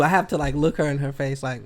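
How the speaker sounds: ordinary talk, nothing marked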